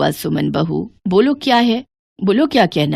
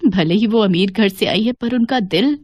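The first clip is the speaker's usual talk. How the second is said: sobbing, wavering apology that builds into hurt; tearful breakdown. Acted. Mother-in-law begging her daughters-in-law's forgiveness, breaking into tears. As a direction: plead tearfully, breaking off into sobs. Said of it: "फूट फूट कर रो पड़ती हैं".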